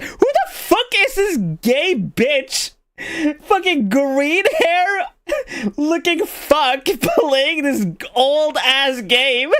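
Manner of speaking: high, slow mocking viewer. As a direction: sneer like a baffled random viewer. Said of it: "People are gonna fucking click on this and be like".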